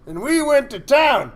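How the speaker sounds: loud, high and slow with glee; excited storytelling. Real, not acted. Still buzzing from the punchline, a loud gleeful retelling. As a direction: still riding the laugh, carried away.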